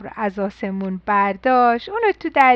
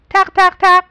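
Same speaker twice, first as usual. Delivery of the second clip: louder, higher knock-knock; knocking sound; 5 semitones higher, 8 dB louder than usual. A narrator's reading. Storyteller knocking on the door, louder.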